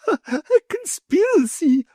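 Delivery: high, slow, thrilled squeal of delight; wife thrilled by the plot, squealing high; delighted cry. A narrator's reading. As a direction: drag out the word with glee. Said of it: "cried the delighted lady".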